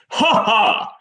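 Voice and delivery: hearty laugh. Acted. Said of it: "sort of heartily laughs like this".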